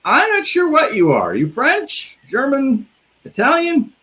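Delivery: put-on high, questioning voice, guessing at the accent; puzzled play director. Director baffled by an actor's accent, high mock-puzzled voice. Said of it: "they're like".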